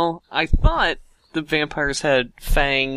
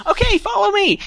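squeaky clicking bat. Acted. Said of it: "he flies out the cave".

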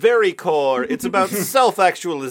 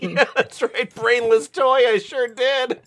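high teasing voice; 9 semitones higher than usual. Acted. Mock-dom reply in persona.

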